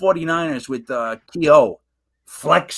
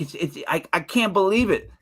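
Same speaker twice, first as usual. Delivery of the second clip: normal talking voice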